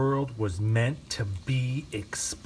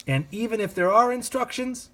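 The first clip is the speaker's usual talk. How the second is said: plain reading voice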